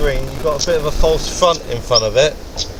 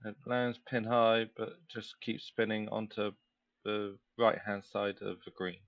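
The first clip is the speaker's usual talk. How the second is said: hushed, softer golf commentary; quiet voice like TV golf coverage; golf commentator; 4 semitones lower, 16 dB quieter than usual. Acted. Hushed play-by-play of own shot.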